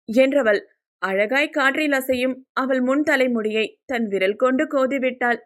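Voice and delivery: ordinary delivery, nothing marked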